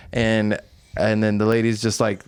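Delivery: normal talking voice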